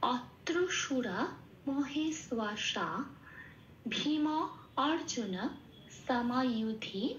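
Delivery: অত্র সুরা মহেশ্বাসা drawn out slowly with pauses; slow recitation. Shown as a demonstration. Teacher reciting the full verse slowly. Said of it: "পুরো শ্লোকটা কি পেলাম?".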